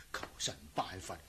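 very soft, quick muttering; hushed, superstitious muttering to oneself; muttered anxious prayer. Acted. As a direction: pray under your breath, hurried and low. Servant prays nervously before opening door.

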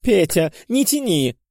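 high, urgent demand. A narrator's reading. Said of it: "потребовала графиня".

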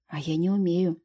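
ordinary delivery

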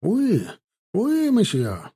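high-pitched "oui, monsieur"; lifted, responsive call; called-back French reply. A narrator's reading. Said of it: "откликнулся он, закашлявшись".

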